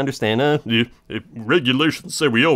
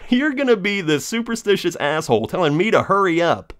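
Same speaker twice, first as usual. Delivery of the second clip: indignant rant; 8 semitones higher than usual. Retold or imitated. Ranting retort at the officer.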